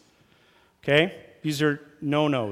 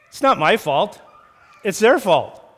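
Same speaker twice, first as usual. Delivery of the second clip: mock defensive; 4 semitones higher, 5 dB louder than usual. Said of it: "it's saying is".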